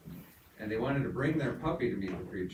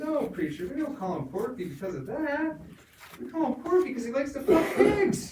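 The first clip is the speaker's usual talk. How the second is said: put-on higher kid voice, breaking into a laugh; innocent kid; 5 dB louder than usual. Boy correcting the preacher at the punchline, high and laughing. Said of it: "they said".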